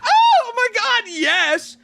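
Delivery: high, loud thrilled yes; over-the-top thrilled squeal; excited shout. Retold or imitated. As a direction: gush with delight, loud and high. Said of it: "I was like".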